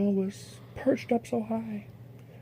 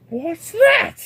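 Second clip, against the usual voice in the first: much higher, loud indignant what's that; snorted question; 11 semitones higher, 7 dB louder than usual. Acted. Yertle snorts at the moon above him. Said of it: "snorted Yertle".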